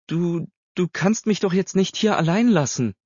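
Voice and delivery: high, stammering protest; alarmed pleading. A narrator's reading. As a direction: plead in alarm, tripping on the first word. Aghast girl pleads not to be left. (erschrocken flehen, mit hoher Stimme)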